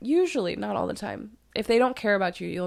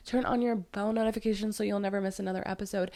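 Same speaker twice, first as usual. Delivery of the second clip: ordinary delivery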